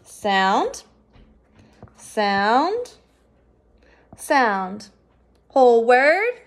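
each sound drawn out with a rising tune, then the word. Shown as a demonstration. Teacher leading the class sound by sound, rising each time. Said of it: "read these sounds with me".

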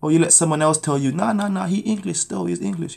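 ordinary talk

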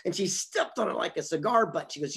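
ordinary talk

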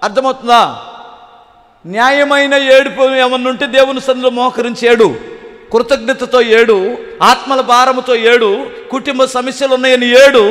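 ordinary talk, nothing marked

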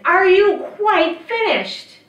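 high, loud, drawn-out holler; slow, impatient witch's shout; witch yelling. A narrator's reading. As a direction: yell with mounting impatience. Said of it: "she would yell again".

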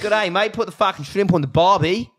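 broad cartoon Australian, amused; Australian accent. Shown as a demonstration. Showing co-hosts how it's done, hammed-up Australian accent. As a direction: put on a jokey Aussie twang. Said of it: "what's the Australian accent?".

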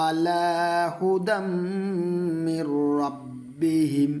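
stretched recited syllables; drawn-out Quran reading; slow recitation. Shown as a demonstration. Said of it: "এখন একটু উচ্চারণ দিব".

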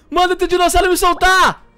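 loud high-pitched yell; panicked shout. Real, not acted. Grabbed by dinosaur, yelling at partner.

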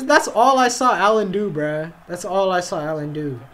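normal talking voice

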